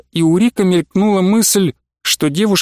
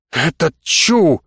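slow, scornful brush-off; drawn out with clear dislike; hostile dismissal; much slower than usual. A narrator's reading. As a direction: dismiss him with open hostility. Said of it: "с явной враждебностью отмахнулась девушка".

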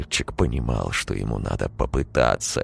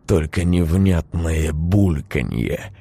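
ordinary delivery, nothing marked

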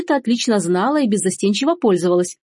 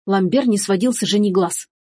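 plain reading voice

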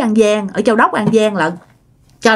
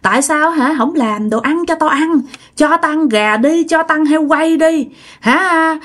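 high demanding spirit voice; possessed cursing; 5 semitones higher than usual. Retold or imitated. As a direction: yell for food as the possessed grandma. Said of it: "bà chửi um sùm trời đất". (giọng bà bị nhập đòi ăn, chửi ầm)

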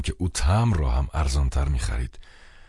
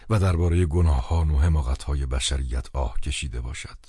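plain reading voice

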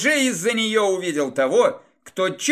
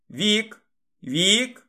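hoarse, strained voice; hoarse croak; 4 semitones higher, much slower than usual. Acted. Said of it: "прохрипел маг тьмы".

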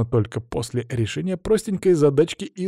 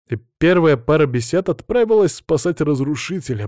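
ordinary delivery

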